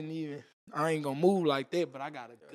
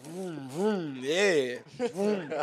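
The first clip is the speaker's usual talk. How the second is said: engine revving sounds; much slower than usual. Retold or imitated. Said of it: "be like".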